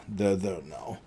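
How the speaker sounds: calm them down with a hush; hushed, soothing repeat of They're there now. Acted. Strahd soothing, repeated softly.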